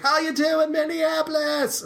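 high, hammed-up Australian frontman greeting, slow on the city name; mock Aussie rock singer hyping the crowd; imitated Australian accent. Opening band singer greeting the Minneapolis crowd, mock Australian accent. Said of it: "it was an Australian accent".

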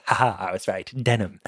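low, soft, mock-refined vampire voice; hushed smug Edward. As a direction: purr it like a proud wine taster. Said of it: "opens it up and goes".

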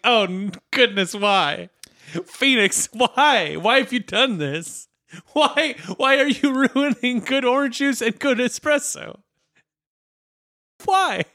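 high-pitched despairing whys; exasperated plea. Acted. Reading the article and begging Phoenix to explain, exasperated. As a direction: plead in mock anguish, voice climbing.

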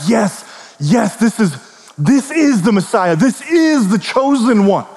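rising, eager proclamation of the chosen one; joyful affirmation. Acted. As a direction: announce him with growing wonder. Prophets affirm the Messiah.

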